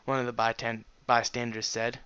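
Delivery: usual speaking voice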